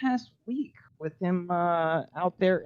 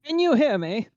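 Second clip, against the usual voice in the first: higher-pitched put-on British accent; 7 semitones higher, 4 dB louder than usual. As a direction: call out in a mock British accent. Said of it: "was that supposed to be a British accent".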